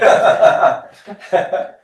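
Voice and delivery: a short breathy laugh at own joke; chuckling at the silly mnemonic; amused laugh. Real, not acted. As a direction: laugh it off lightly. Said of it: "laughs at own joke".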